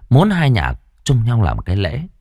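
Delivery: plain reading voice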